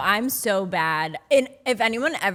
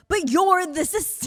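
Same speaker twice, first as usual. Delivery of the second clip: high-pitched exasperated comeback; incredulous retort; 8 semitones higher than usual. Retold or imitated. Traveler to airline staff blaming the system, incredulous. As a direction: throw it back at them in high disbelief.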